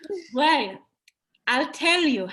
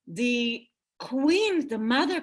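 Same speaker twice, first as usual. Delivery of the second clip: ordinary delivery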